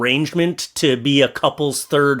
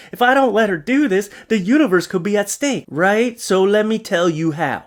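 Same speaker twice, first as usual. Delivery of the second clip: higher, earnest mock storytelling; cheater spinning excuses; 4 semitones higher than usual. Cheating bro justifying himself to babe, mock-earnest. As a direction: plead the absurd case in a raised voice.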